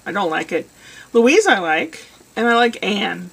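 ordinary talk

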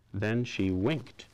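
usual speaking voice

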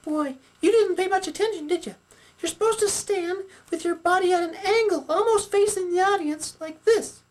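very high-pitched puppet teacher; Sally puppet, instructing. Acted. Sally explains playing to the audience, instructing puppet voice. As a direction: explain it all in a high squeak.

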